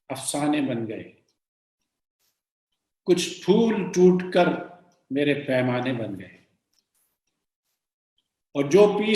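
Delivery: measured Urdu verse reading with pauses; poem recitation. Acted. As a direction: lift each opening phrase, let the refrain fall away. Recites the poet's couplets.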